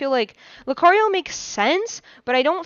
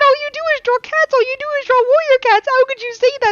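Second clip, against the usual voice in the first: much higher, fast mock indignation; outraged fan voice; 10 semitones higher, faster than usual. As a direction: play the betrayed fan, squeaky and fast. Voicing outraged Warrior Cats fans.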